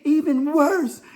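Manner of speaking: high-pitched mock outrage; imitated disgusted woman. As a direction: proclaim it high, mimicking her disgust. Repeats woman's scornful comeback.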